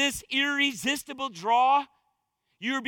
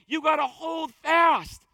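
preaching shout; 3 semitones higher, slower than usual. Real, not acted. Preacher shouting at the congregation to hold fast. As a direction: cry it out with full force.

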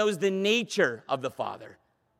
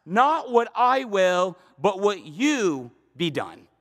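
slow surrender; slower than usual. Retold or imitated. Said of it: "where it says".

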